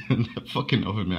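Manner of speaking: laughing through the words; real laughter while talking. Real, not acted. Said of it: "I can't stop laughing about it".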